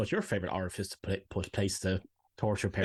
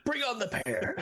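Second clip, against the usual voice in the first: mock cry. Joking exclamation, voice jumps.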